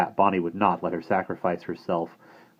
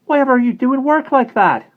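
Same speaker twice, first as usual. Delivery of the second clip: put-on high, loud boyish call over the railings; eager boy calling; 10 dB louder than usual. Simon calling to Bonnie through the railings, high and eager. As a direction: call out to her in a young boy's raised voice.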